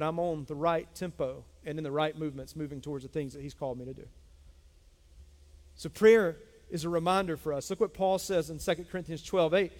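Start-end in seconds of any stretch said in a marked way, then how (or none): none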